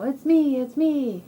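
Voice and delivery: excited cow. Acted. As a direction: sing out proudly as the unmasked cow. Cow reveals itself.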